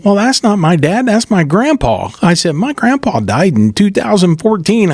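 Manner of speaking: high, lively disbelief when telling it; retold surprise. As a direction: recall the shock of seeing grandpa's name. Finds dead grandpa on rolls.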